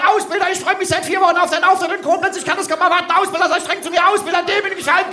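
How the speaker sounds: high, fast overexcited fan chant; eager fan shout. Shown as a demonstration. The front-row guy is told to yell, high gushing fan. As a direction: gush like a thrilled recruit. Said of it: "rufst ganz laut".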